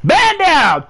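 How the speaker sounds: high, loud exasperated yell at the game; sharp, high command; upset yell. Real, not acted. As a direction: burst out in frustration. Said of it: "Sorry, I'm getting upset".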